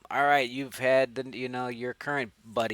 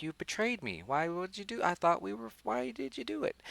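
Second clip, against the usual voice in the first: much higher mock-hurt voice; plaintive put-on whine, climbing higher to the end; betrayed doll; 9 semitones higher, 4 dB quieter than usual. Chucky accusing Andy of betrayal, mock hurt.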